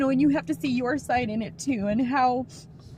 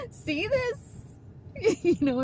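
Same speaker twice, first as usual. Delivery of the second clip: a small embarrassed laugh, pitched up; nervous laugh; 7 semitones higher than usual. Real, not acted. Mother laughing off tears while telling viewers about her son, laughing.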